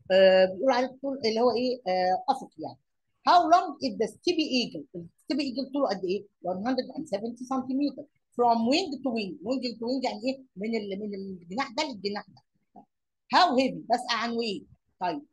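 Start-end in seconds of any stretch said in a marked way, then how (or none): 3.3-4.8 emphatic question. Shown as a demonstration. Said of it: "teacher reading the question". 13.3-13.8 emphatic question word. Shown as a demonstration. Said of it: "teacher announcing question word".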